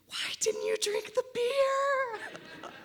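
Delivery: a high, slow, breathless whisper; stunned whisper. Retold or imitated. Boss staring at her after the pothole, whispering in disbelief. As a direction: whisper it slowly, like a boss's I-told-you-so. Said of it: "He's going".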